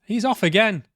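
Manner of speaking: high, loud fed-up cry; exasperated outburst. Real, not acted. Player shouting as the cowardly character runs off again. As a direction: throw it out in disbelief.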